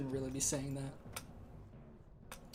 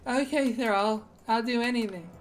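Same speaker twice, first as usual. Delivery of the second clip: higher, louder eager devotion; 8 semitones higher, 6 dB louder than usual. Pretend. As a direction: gush like an eager fan. Player eagerly answers the warchief.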